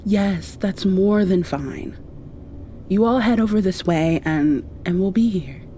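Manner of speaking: ordinary talk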